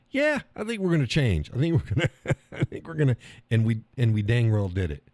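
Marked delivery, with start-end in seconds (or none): 0.1-3.2 mocking quote. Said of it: "a country that said".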